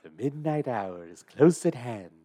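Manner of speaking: slow, drawn-out horror narrator; spooky movie-host voice, unhurried; Vincent Price. Acted. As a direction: intone it with sinister theatrical relish. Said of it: "what you said to get to Vincent Price".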